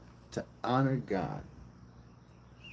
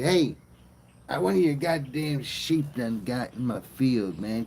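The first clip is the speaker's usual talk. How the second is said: acted angry summons, starting on a raised shout; calling out; 2 semitones higher than usual. Cain luring his brother out to the field, calling out.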